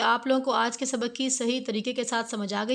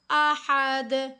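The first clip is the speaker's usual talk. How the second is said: अहद drawn out very slowly, hamza short; slow drawn word; much slower than usual. Shown as a demonstration. Teacher modelling the word for students, very slow. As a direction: stretch अहद slowly without lengthening the hamza.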